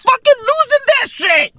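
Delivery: high, exasperated outburst; high-pitched incredulity. Real, not acted. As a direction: voice leaps up high on the rant. Worked-up rant, voice jumps high.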